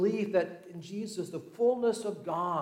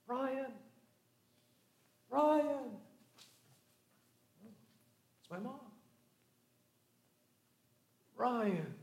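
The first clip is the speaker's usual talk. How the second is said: put-on mother calling a lost child's name from afar, higher; 5 semitones higher, 7 dB quieter, much slower than usual. Mother searching in the rain, calling her son's name. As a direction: call his name into the rain.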